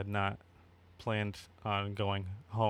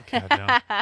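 high, loud God no, half-laughing; mock horror; 13 semitones higher, 8 dB louder than usual. Real, not acted. Birthday guy asked for wise words, squeaky loud recoil. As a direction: recoil as if the idea is absurd.

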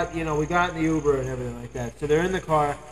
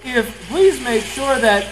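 louder, higher phone-system imitation; automated service; 7 semitones higher, 7 dB louder, slower than usual. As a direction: mimic a recorded callback announcement. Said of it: "this is an automated service".

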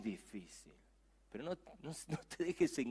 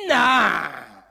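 shouted nah; 6 semitones higher, 15 dB louder than usual. Retold or imitated. Teen's reaction acted out.

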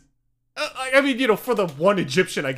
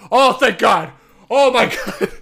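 loud, high, relieved outburst with a laugh; relieved oh thank God; 6 semitones higher, 9 dB louder than usual. Retold or imitated. American caller relieved the Egyptian speaks English, loud. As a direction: burst out with relief. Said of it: "He's like".

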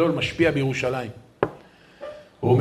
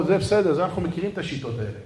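usual speaking voice

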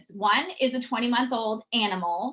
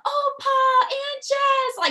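put-on high, slow sing-song call of a happy child; excited calling out; 5 dB louder, much slower than usual. Kids running to grandpa and aunt, high sing-song call. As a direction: stretch it out like kids spotting family.

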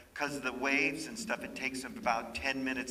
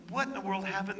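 higher, astonished question; retold shock, pitched up; 9 semitones higher, 4 dB louder, faster than usual. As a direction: ask it in disbelief.

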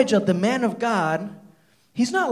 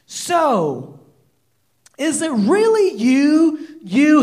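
slow, higher and louder disbelief; Ahab exclaiming; 5 semitones higher, 4 dB louder, much slower than usual. Retold or imitated. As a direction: slowly call out in shock. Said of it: "Ahab exclaimed".

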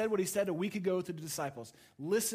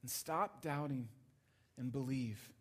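soft, gentle urging as Jesus; loving; 4 semitones lower, 6 dB quieter than usual. Retold or imitated. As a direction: lead him softly into belief. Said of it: "he says very lovingly".

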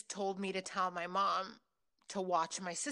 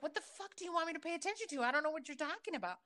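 higher, fast frustrated rant; arguing back at the voice, exasperated and fast; 7 semitones higher, 4 dB quieter, much faster than usual. Retold or imitated. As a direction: fire it off, fed up. Arguing with the voice in head.

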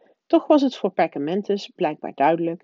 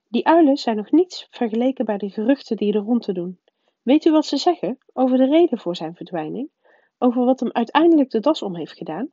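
higher, louder prim schoolmistress, insistent; the strict witch pressing her point; stern professor voice; 6 semitones higher, 5 dB louder than usual. A narrator's reading. McGonagall voiced by the narrator.

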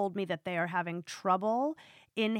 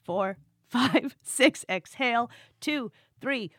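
laughing through the count; 5 semitones higher, 9 dB louder, slower than usual. Real, not acted. Laughs while finishing the count.

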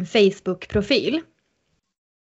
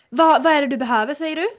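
mock yell, high-pitched and fast; retold shouting; 6 semitones higher, 3 dB louder, faster than usual. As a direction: imitate her loudly demanding an answer, pitched up. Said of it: "Så skriker hon så här". (härmar hennes höga skrikande)

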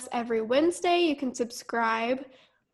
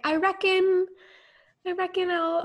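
put-on high, louder Australian accent; Australian impression; 7 semitones higher, 3 dB louder than usual. A fan copying an Australian influencer's cute jargon, high Australian voice. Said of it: "She'd be like".

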